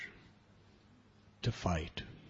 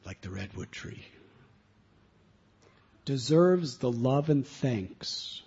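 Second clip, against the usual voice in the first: slow, much louder oratory; solemn reading; 6 dB louder, slower than usual. Real, not acted. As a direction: declaim like a rousing speech. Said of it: "permission to read".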